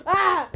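high, loud shriek; frightened scream. Real, not acted. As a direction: scream at a jump scare. Monster scare in game.

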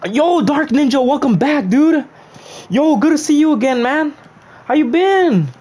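squeaky high greeting voice. Pretend. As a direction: pitch way up, cheerful.

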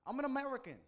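high, loud indignant cry; protesting shout. Retold or imitated. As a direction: insist loudly on who you are. Protesting to the border officer.

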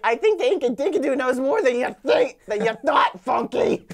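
loud, emphatic cartoon retort; cartoon quote. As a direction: build to a shouted punch on the last words. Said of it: "and he's like".

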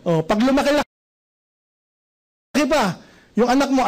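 usual speaking voice

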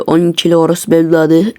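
ordinary talk, nothing marked

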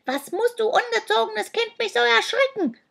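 put-on high-pitched, scolding old creature; indignant high squawk; hissing creature. A narrator's reading. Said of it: "zischte die Gestalt".

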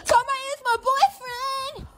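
slow wailing cry. Acted. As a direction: sob the line out. Same words, S1 echo.

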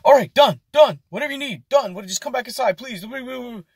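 high, frantic, pleading manager; panicked manager pleading. Retold or imitated. Manager begs cook not to leave, high frantic plea. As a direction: squeal it like a boss losing it. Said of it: "manager gives in".

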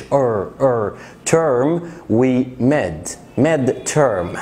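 term with rounded er, not ترم. Shown as a demonstration. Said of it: "ضم ال إي آر شوية في الإنجليش". (ضم الإي آر في term)

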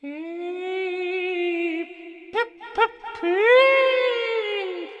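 very high, loud train-whistle shriek; long piercing peeps round short pips. A narrator's reading. Percy whistles at the shed, shrieking. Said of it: "he shrieked".

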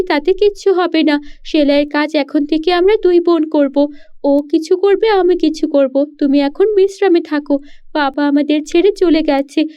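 pleading daughter. Acted. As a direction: insist lovingly she must rest.

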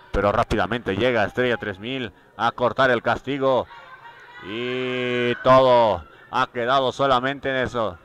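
ordinary talk, nothing marked

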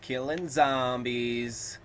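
drawn out and proud; slow, cocky boast; slow drawl. Acted. Slow boastful gamer line.

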